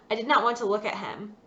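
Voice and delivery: usual speaking voice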